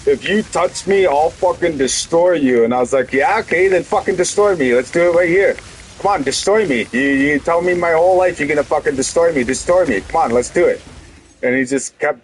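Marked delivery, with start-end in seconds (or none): 0.1-2.6 pitched higher, mocking menace, the second half slow; imitated brother's threat. To his brother outside Tim Hortons, mocking threat. 3.1-10.8 pitched higher, mock-goading, daring him; retold taunting dare. Daring his brother to fight in the lot, mock goading.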